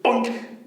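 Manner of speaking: punchy cartoon knock; bonk sound. A narrator's reading. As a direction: hit it like a stick blow. Imitated blow of stick.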